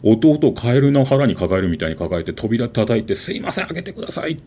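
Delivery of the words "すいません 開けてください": put-on high, pleading child's plea, softer; 必死の懇願. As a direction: beg through the door like a scared boy. 扉を叩き入れてと懇願. (扉の向こうに高く弱々しく懇願する子供)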